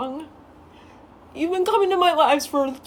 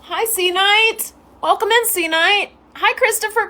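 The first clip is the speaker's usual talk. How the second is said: cheery welcome; 7 semitones higher, 3 dB louder than usual. Real, not acted. Welcoming a new viewer into the stream cheerfully. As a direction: welcome them in with a lift.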